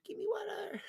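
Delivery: tiny high demanding pet voice; cat's demanding voice. Retold or imitated. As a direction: play the cat whining for water. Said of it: "He's like".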